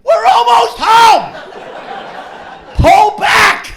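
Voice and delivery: high, drawn-out full shout; loud, slow pleading yell; yelling at screen. Retold or imitated. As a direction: holler a desperate warning from the front row. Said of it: "We're yelling at him".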